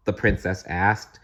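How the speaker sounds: ordinary delivery, nothing marked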